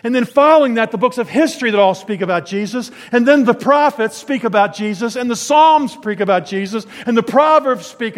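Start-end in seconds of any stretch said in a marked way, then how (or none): none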